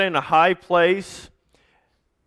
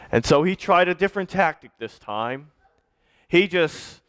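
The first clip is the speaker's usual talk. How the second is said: ordinary delivery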